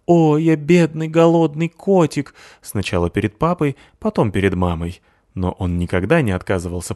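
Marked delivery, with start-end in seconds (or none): 0.1-2.3 slow, higher, louder whiny pitiful voice; poor hungry kitty act. Acted. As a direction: beg like a starving cat. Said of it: "сыграв".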